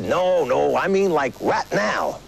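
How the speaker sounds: put-on slow drawn-out Southern kid; a kid correcting him in a Southern drawl; kid's Southern drawl. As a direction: drawl it like a Memphis boy. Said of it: "He said".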